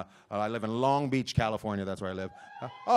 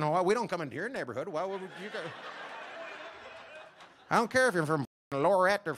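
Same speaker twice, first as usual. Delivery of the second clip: mumbling dog; 5 semitones higher, 4 dB quieter than usual. Acted. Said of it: "Just mumbling".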